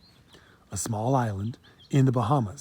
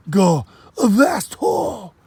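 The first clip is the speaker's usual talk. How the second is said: slow, much higher Jamaican cry; Jamaican accent shout; 9 semitones higher, 6 dB louder, much slower than usual. Acted. Kofi sends the boy off, loud Jamaican cry. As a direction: shoo him off in patois. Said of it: "heavy accent".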